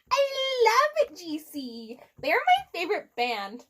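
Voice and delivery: high, very slow gushing; fangirl gushing. Acted. Ebony gushing to Draco about her favorite band, high and slow. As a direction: draw out the love for the band.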